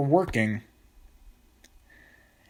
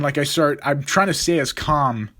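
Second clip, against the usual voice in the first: normal talking voice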